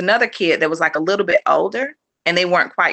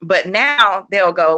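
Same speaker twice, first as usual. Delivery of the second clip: ordinary talk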